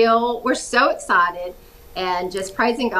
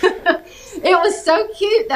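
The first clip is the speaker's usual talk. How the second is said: laughing through the words; 9 semitones higher, 4 dB louder, slower than usual. Real, not acted. Amused guest laughing about the newspaper's fake accent claim. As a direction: chuckle it out, amused.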